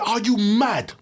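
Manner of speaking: high, loud London challenge; mock London accent. The actor confronting the director, in a mock London accent.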